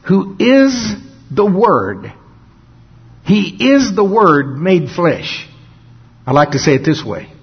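usual speaking voice

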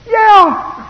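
loud, high-pitched astonished yeah; amazed yell. Acted. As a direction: shout it like a wowed churchgoer. Congregation's amazed reply, acted out.

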